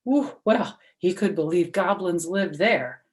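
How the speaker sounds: usual speaking voice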